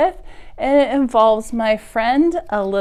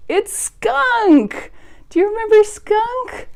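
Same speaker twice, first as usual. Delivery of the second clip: much higher, bright announcement; excited reveal; 3 dB louder than usual. Real, not acted. Teacher showing children the skunk puppet, excited. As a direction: present the puppet with delight.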